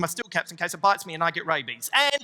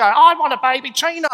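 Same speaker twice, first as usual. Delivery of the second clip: put-on higher, louder little girl; whiny child; 7 semitones higher, 10 dB louder than usual. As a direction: beg like a five-year-old. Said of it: "five-year-old Georgia go".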